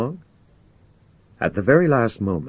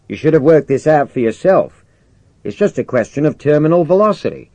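loud, raised briefing voice; brusque commander; 6 semitones higher, 6 dB louder than usual. Acted. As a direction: overrule him, then lay out the orders. Commander overrules and briefs Jimmy.

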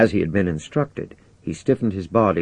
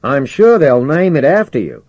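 high, loud praise; enthusiastic; 7 semitones higher, 8 dB louder than usual. Acted. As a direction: cheer him on from far below. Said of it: "exclaimed the commander enthusiastically".

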